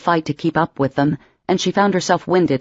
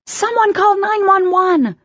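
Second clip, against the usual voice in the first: much higher, slow, drawn-out scream for help; panicked scream; slower than usual. Acted. Emma screaming for help over fallen Patrick, panicked. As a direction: shriek it out, fighting panic. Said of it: "she screamed".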